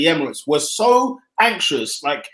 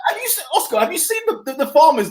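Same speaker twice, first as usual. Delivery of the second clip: incredulous; 8 semitones higher than usual. Real, not acted. Incredulous question.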